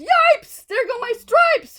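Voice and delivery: very high, loud yelp; zebra yelling. Acted. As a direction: yelp in alarm. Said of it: "The zebra yelled".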